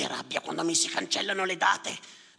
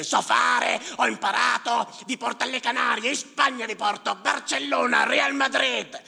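much higher, loud bragging for a laugh; joking boast; 10 semitones higher, 7 dB louder than usual. Acted. Yusuf joking to the crowd, loud and boastful. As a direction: brag like it's all a joke. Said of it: "Lui diceva per ridere".

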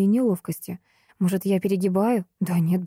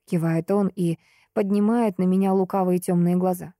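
ordinary delivery, nothing marked

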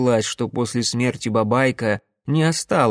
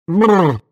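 loud, higher lip-trilled brrr; shuddering brrr; 5 semitones higher, 7 dB louder than usual. A narrator's reading. Alyoshka jolts awake in the cave, shuddering. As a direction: shake off drowsiness with a brrr. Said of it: "потряс головой младший Лапа Данилевский".

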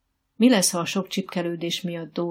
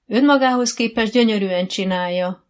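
loud, higher-pitched call back; raised voice, projecting across the arena; shouted defence; 4 semitones higher, 9 dB louder than usual. A narrator's reading. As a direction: shout up for your pupil. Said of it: "kiáltott Dóra".

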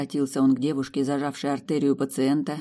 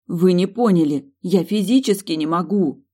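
higher and louder, half angry, half scared; irritated, frightened cry; 5 semitones higher, 6 dB louder than usual. A narrator's reading. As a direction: snap back in fear and irritation. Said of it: "Одновременно раздражённо и испуганно воскликнула".

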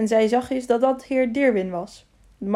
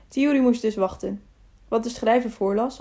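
plain reading voice

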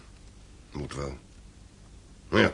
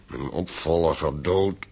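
slow anguish; much slower than usual. Acted. Father grieving lost heir.

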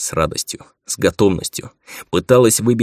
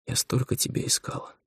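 whispered, barely voiced words; near-soundless whisper; 6 semitones higher, 10 dB quieter than usual. A narrator's reading. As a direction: mouth it with almost no voice. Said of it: "сказала она одними губами". (шёпотом, одними губами)

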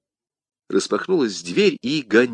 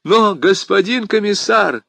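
high, urgent appeal; crying out; 11 semitones higher, 5 dB louder than usual. A narrator's reading. Bonacieux interrupts the commissar to protest, crying out. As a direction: cry out to be heard. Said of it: "вскричал Бонасье".

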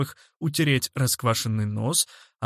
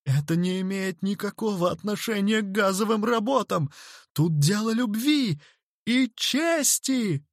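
high, rising indignation; impassioned exclamation; 8 semitones higher than usual. A narrator's reading. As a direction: protest with heroic fervour. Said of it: "воскликнул Дик". (пылко восклицать)

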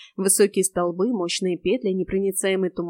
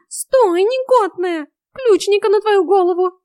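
high, loud wail of protest; high-pitched cat yell; howling cat; 13 semitones higher, 10 dB louder, slower than usual. A narrator's reading. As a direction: howl it as an outraged cat. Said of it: "взвыл Васька".

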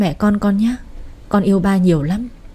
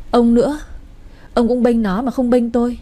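higher, hurt and accusing wife; aggrieved complaint; 4 semitones higher than usual. Acted. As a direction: throw it back at him, wounded and higher. Mother-in-law quarrels with husband.

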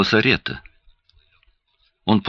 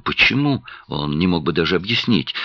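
usual speaking voice

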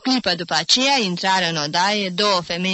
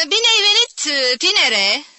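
much higher, louder prickly greeting; joking but stung; 9 semitones higher, 6 dB louder than usual. A narrator's reading. Sour aunt welcoming nephew, jokingly stung. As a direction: greet him with a barbed joke. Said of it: "zise în glumă, dar înțepată".

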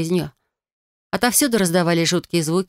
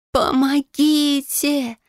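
high drawn-out wail for help; sobbing shout; 8 semitones higher, much slower than usual. Acted. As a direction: cry out through sobs, slowly. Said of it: "в голос рыдая прокричала я".

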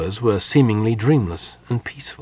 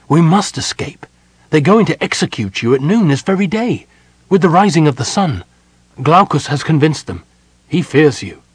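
urgent plea; 7 semitones higher, 6 dB louder than usual. A narrator's reading. Sylvan urges Mina to flee.

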